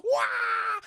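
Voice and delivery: high, loud mock martial arts yell; mock kung fu cry. [name] bursting in to fight, high mock kung fu cry. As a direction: screech it like a movie kung fu fighter.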